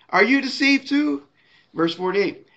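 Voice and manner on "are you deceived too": high-pitched challenge, voicing the Pharisees; pressuring question. Pharisees flip it around on the officers, pressuring. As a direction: lean on them with a much higher-pitched question. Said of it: "put this peer pressure on them".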